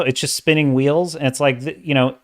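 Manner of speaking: ordinary delivery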